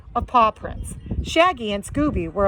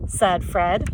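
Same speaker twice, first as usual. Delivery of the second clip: plain reading voice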